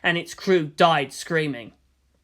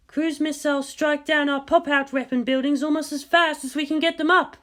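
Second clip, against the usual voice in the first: higher, hurried battle report; urgent report; 10 semitones higher than usual. Acted. Officer reporting missile strikes, urgent. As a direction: push the report out under pressure.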